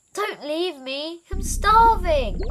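slow, high, loud wail; pleading call. A narrator's reading. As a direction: call after them, drawing out the plea. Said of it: "called Wilbur".

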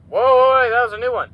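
loud, high alarmed whoa; startled shout. Real, not acted. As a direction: cry out at the surprise. New monster appears.

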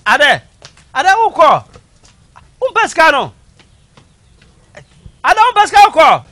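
yell, building in fury; angry shout. Acted. Father yelling at his child.

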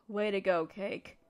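mock yourself quietly, alone now; bitter self-mockery. Bitter self-talk after sighing.

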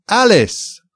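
very high, piping call; shrill little voice. A narrator's reading. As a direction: squeak the name out at the top of his voice. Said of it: "at the top of his shrill little voice".

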